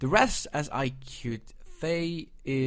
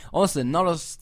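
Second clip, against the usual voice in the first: usual speaking voice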